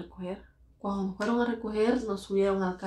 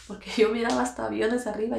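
usual speaking voice

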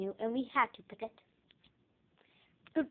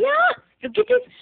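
excited squeal; 13 semitones higher, 14 dB louder than usual. Real, not acted. Showing off the lizard cage.

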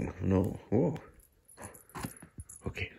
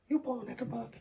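squeaky high pet-talk voice; 14 semitones higher, 5 dB quieter than usual. Real, not acted. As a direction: coo it up high at the cat.

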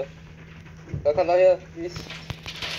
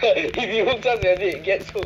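teasing, laughing echo of the warning; laughing mock repeat; 6 semitones higher than usual. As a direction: giggle through a mocking repeat. S2 mockingly repeats S1's warning.

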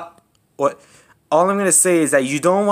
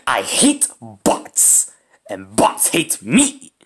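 slow mock quote; 7 semitones lower, much slower than usual. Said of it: "some people say".

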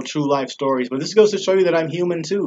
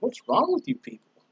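exasperated lecture; 5 semitones higher than usual. Retold or imitated. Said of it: "I'm like".

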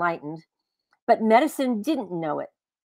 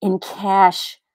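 hissed; 5 dB louder than usual. Acted. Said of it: "she hissed".

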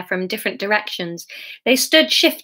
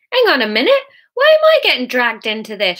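loud, raised protest from a crowd; shouted; 4 semitones higher, 6 dB louder than usual. A narrator's reading. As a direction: shout up indignantly over the crowd. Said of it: "shouted Martin Young from the crowd".

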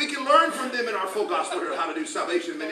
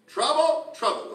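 trouble, trouble called out higher and louder; the Lord's warning, raised; 9 semitones higher, 6 dB louder, slower than usual. Retold or imitated. Preacher retelling God's warning, calling it out louder. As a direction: ring out both troubles as a divine warning. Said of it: "I heard trouble two times".

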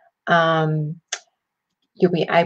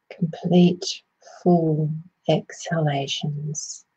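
calm meditation voice with long pauses; unhurried phrases, easing listeners inward; guided meditation. Acted. Meditation host guiding listeners to settle and ground, soothing guided meditation.

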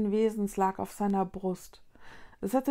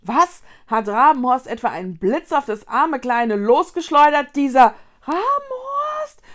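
indignant Lunis; 5 semitones higher, 11 dB louder than usual. A narrator's reading. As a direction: exclaim in outrage, stretching the final name. Lunis indignantly ask about Rabenhorst.

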